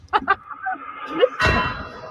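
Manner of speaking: high breathy mocking cackle; a smug, airy victory laugh; [name] laugh. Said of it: "There's a laugh for Shadow".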